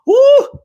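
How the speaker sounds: high loud whoop of joy; joyful whoop. Real, not acted. Feeling the spirit, a loud joyful whoop. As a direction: let out a shout of joy.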